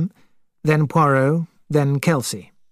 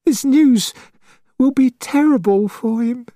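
grieving mother voice; 10 semitones higher than usual. Acted.

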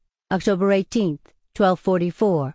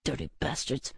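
very soft, sour aside; muttered curse; 3 semitones lower, 11 dB quieter than usual. A narrator's reading. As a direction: grumble it under your breath. Grumbling at customers mocking him.

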